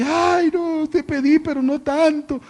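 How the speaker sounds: high, loud half-joking lament; mock crying wail. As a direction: play a wife wailing in mock despair. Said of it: "Pero luego está llorando". (esposa llorando, lamento exagerado en broma)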